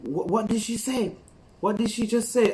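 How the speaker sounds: high, baffled imitation of confused listeners; puzzled Canadians. Canadians baffled by the girl's patois, high and confused. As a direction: ask it totally lost. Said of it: "The people were looking like".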